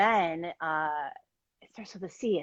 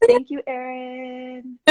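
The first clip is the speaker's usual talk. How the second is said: high, drawn-out thank-you; long, lifted, grateful singsong; gushing thanks; 6 semitones higher, 4 dB louder, much slower than usual. Retold or imitated. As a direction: stretch it out slowly and high, relaying a fan's thanks. Reading out a viewer's grateful comment.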